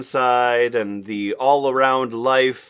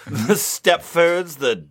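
ordinary talk

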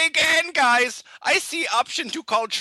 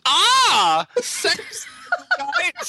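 high squealing excitement; mock excited squeal; 13 semitones higher, 3 dB louder, much slower than usual. Reading stats aloud, high squealed delight. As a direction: squeal it out, hammed-up. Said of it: "that should be more of a shocked scream".

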